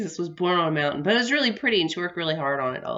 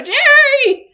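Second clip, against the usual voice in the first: shrill, much higher, loud yell of the name; mom screaming his name; 13 dB louder than usual. Retold or imitated. Mom yelling at dad about the fan, a loud, high scream. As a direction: yell across the house at him, way up high. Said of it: "she'd go".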